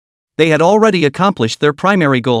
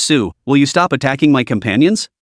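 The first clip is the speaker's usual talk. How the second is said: ordinary delivery, nothing marked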